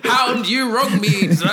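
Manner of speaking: loud, slow, high laughing theatrical challenge; Shakespearean nobleman. Retold or imitated. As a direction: ham it up like a stage duel. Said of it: "Some Shakespearean story".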